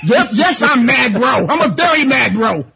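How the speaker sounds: high, loud mock-mad yelling. Real, not acted. Caller yells how mad he is, high and loud. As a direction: yell that you're mad, bro.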